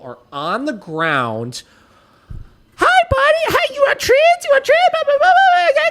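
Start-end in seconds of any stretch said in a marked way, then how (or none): 2.8-5.9 much higher, louder cooing at a dog; mocking the vet's doggy voice; high sing-song baby talk to a dog. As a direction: coo at the dog like a puppy. Vet baby-talks to the dog.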